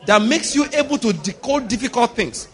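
loud, high, driven declarations; full-force preaching. Real, not acted. Preacher rousing the congregation to speak to their souls, full-force preaching. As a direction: preach it hard, hammering each phrase.

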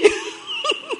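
high burst of laughter. Real, not acted. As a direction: laugh freely.

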